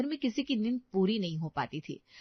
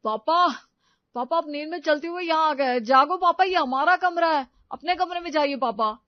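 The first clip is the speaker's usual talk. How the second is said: higher, louder urgent pleading; urgent waking call; 7 semitones higher, 9 dB louder than usual. Acted. Son urgently waking his sleepwalking father, loud. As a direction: shake him awake with your voice.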